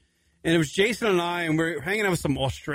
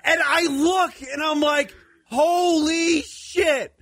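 high, loud, slow holy shit; shocked; 12 semitones higher, 3 dB louder, much slower than usual. Real, not acted. Seeing his friend palm a stranger's head, loud shocked disbelief. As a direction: stretch it out in horrified disbelief.

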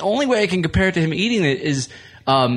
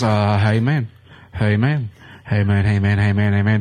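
put-on lower, drawn-out drunk buddy calling out; lightly voiced sloppy drunk friend, pitched down; impression of drunk Marty; 7 semitones lower than usual. Drunk friend in the back seat pestering the driver, lower and slurry. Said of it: "this is my impression of him".

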